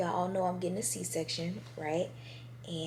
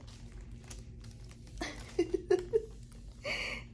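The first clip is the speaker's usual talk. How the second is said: amused laugh; 11 semitones higher than usual. Real, not acted. Laughing at her own hat.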